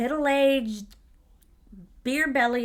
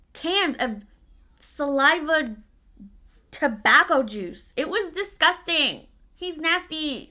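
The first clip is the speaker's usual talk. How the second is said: higher-pitched, emphatic revulsion; disgusted; 4 semitones higher, 3 dB louder, slower than usual. Real, not acted. Telling viewers about the manager's spit cans, high-pitched disgust. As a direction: recoil in real disgust, voice pushed up.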